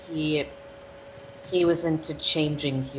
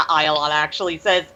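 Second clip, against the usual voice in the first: ordinary talk, nothing marked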